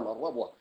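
"الربوة" said ar-rabwa, with an a after the ر; a vowel after ر. Shown as a demonstration. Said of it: "وفتحها". (نطق "الربوة" بفتح الراء)

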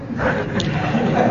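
a short, breathy chuckle; soft laugh. Real, not acted. Teacher chuckling at the husband's answer, soft laugh. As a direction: laugh quietly at the absurd answer.

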